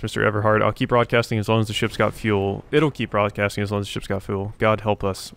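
ordinary talk

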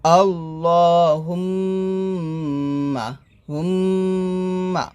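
slow আল্লাহুম্মা with a long hummed m; nasal ghunnah. Shown as a demonstration. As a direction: linger on the ম of হুম্মা through the nose. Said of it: "গুণ আদায় করতে হবে".

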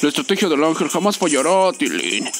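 "La estrategia del ángel jamás fallará" in a put-on, hollow heroic voice; mock-epic boast. Streamer to viewers, mock-epic heroic boast. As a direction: declare it like a movie hero. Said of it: "repeated plainly".

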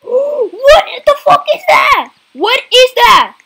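slow, high, loud frightened cry; scared horror game streamer. Real, not acted. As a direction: gasp and recoil in fear. Horror game face appears.

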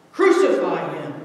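a demanding cry from the mob; crowd cry. A narrator's reading. Crowd crying out to Pilate, loud. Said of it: "they still cried".

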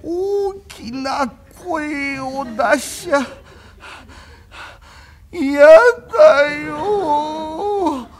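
very slow, whimpering invalid; feeble sick voice. Acted. Sick young master begs Kuma not to shout, feeble whimper. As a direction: speak like a lovesick invalid near tears.

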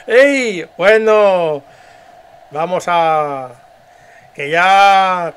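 loud jovial leader to a crowd; dubbed leader. Acted. Leader urging the crowd to clap and not cry, loud public address. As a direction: call out praise over applause. Voy a hacer otra vez el doblaje.

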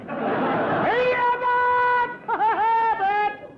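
high, loud panicked calls; frightened yelling. Acted. Alone in the dark house, Costello calls Abbott in panic. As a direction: shout for help in fear.

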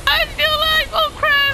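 very high-pitched, shrieking squeal; high panicked squeak of a voice; squealed excitement. Real, not acted. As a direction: squeal it in frantic disbelief. Squealed relief turning to alarm.

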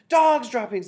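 very high, loud mass alarm; shouted. A narrator's reading. Whole assembly of witches raising the alarm, shouted. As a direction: shout it high as the dreaded cry. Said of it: "they shouted".

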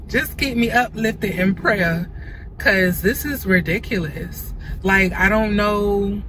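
ordinary delivery

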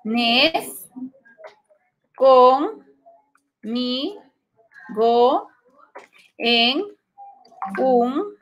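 Vienes conmigo en un viaje alocado split into slow separate chunks; syllable by syllable. Shown as a demonstration. Teacher splitting the verse for students, slow syllable by syllable. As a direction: pause after each piece of the verse. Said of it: "Entonces separamos".

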